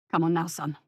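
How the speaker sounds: low father's voice; coaxing father. A narrator's reading. Father coaxing his son to leave, low voice.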